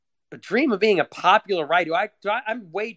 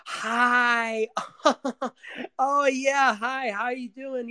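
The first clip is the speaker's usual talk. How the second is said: high, forced cheery hello with fake laugh, much higher; fake cheerful greeting; 10 semitones higher, much slower than usual. Greeting guests at the party, forced fake cheer. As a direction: chirp the small talk like you mean it. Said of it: "fake laugh".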